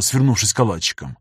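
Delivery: usual speaking voice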